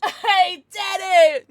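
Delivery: a loud, slow triumphant shout; excited cheer. Real, not acted. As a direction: yell it out in real triumph. Celebrating a card pull.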